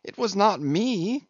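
high, protesting cry. A narrator's reading. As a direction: deny it like an accused servant. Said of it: "cried Doughboy".

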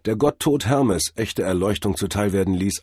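ordinary delivery, nothing marked

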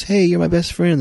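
very high, loud pleading buddy voice; hammed-up sucking up, high and loud; fake-friendly guy. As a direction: squeal it like a phony best pal. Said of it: "the guy goes".

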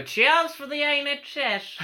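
put-on high, slow, laughing old woman; imitating old woman. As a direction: squeak it out like a late old neighbour. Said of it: "she stopped and go".

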